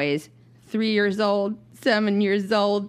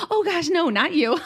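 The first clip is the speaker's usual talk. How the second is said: much higher, laughing, over-polite gasp; Minnesotan apology; 10 semitones higher than usual. Retold or imitated. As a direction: gush a horrified nice-person apology. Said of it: "I'm too Minnesotan for that".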